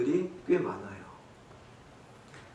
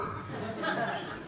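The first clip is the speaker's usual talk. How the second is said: laugh; 7 semitones higher, 10 dB quieter than usual. Real, not acted. Laughs at own joke.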